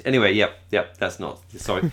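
usual speaking voice

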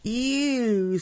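theatrical giant with big pitch swings; storybook giant. A narrator's reading. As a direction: boom out the giant's chant, rising in pitch. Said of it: "said the giant".

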